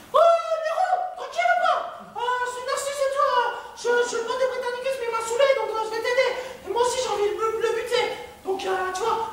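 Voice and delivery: very high squeaky voice; squeaky Narcisse. Retold or imitated. Narcisse offering help to Néron in a very high squeak. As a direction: play a sly little snitch in a squeak. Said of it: "lui faire".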